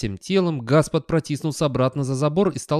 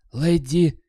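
mildly hoarse call, higher and louder; short raised call; 6 semitones higher, 5 dB louder than usual. A narrator's reading. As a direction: call out to the dog huskily. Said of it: "сипло позвал он".

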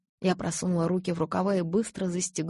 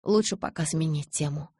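ordinary delivery

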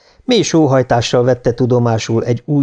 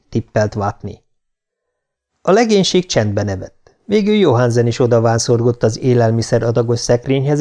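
plain reading voice

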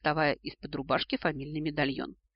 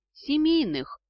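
high, louder grinning retort; toothy grin; 11 semitones higher, 5 dB louder, slower than usual. A narrator's reading. Heroine answers the dragon, grinning back. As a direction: pitch it up through a toothy grin. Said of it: "не хуже предка, осклабилась я".

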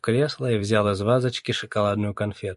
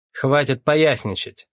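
loud, slow, raised order; barked rebuke; 5 semitones higher, 5 dB louder, slower than usual. A narrator's reading. Captain cutting short the flippant suspect, barking. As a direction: bark it firmly, word by word. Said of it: "рявкнул он".

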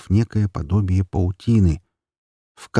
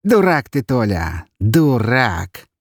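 scolding skeleton; 4 semitones higher, slower than usual. Acted. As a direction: berate him with a sneer.

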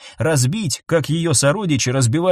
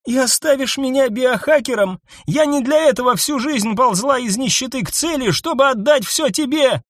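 furious shout; 10 semitones higher than usual. A narrator's reading. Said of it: "яростно крикнула Нисса".

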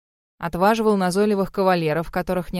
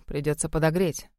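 plain reading voice

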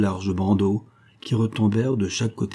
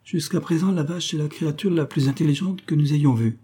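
much higher, exasperated mock outburst; exclaiming; 6 semitones higher than usual. A narrator's reading. Magistrate to his friend about the mute woman, high sarcastic exclamation. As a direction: throw the sarcasm up high in disbelief. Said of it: "s'écria le magistrat".

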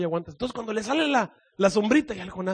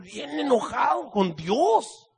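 ordinary talk, nothing marked

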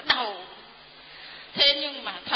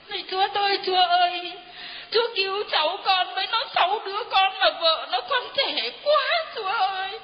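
higher, louder pleading cry to God; desperate prayer; 6 semitones higher, 3 dB louder than usual. Retold or imitated. Retelling her frantic prayer to God, higher and louder. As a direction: beg God aloud, voice breaking.

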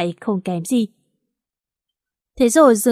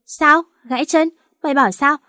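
shocked; 6 semitones higher than usual. Acted. A best friend hears on the phone that her friend broke a leg, shocked. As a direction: jump at the news in alarm.

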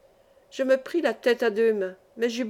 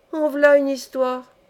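exclamation lente, plus aiguë et plus forte; vieille logeuse; 4 semitones higher, 5 dB louder, slower than usual. Acted. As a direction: une vieille logeuse qui s'étonne. Vieille femme répète. (exclamation de vieille femme, lente et appuyée)

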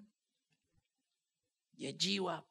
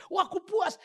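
higher, louder mock delight; mock satisfied churchgoer; 14 semitones higher, 9 dB louder than usual. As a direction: ham up a churchgoer's bliss.

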